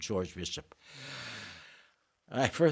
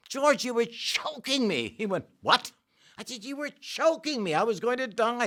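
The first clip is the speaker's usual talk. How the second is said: much higher, pleading retold panic; mock-frightened high complaint to the co-star; panicked protest; 12 semitones higher than usual. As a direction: squeak it out like you nearly died. Retelling being choked onstage.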